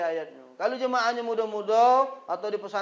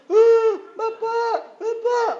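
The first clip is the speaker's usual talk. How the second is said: mock wailing; 7 dB louder, much slower than usual. Mocking child wailing at funeral.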